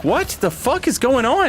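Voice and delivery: high-pitched, exaggerated disbelief; mock bewilderment. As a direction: act out being baffled, voice shooting way up. Acts out own bewildered reaction.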